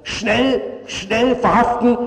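a hinted prosecutor's shout; mild mock yell. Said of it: "schrie die Staatsanwaltschaft".

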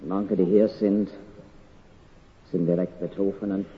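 ordinary talk, nothing marked

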